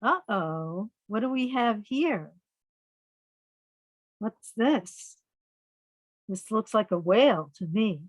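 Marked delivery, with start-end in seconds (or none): none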